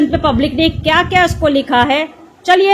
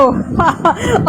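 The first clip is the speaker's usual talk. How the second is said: short rising laugh; a quick amused laugh; mocking laugh; 3 semitones higher than usual. Real, not acted. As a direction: laugh at the supporter. Laughs at the supporter's comment.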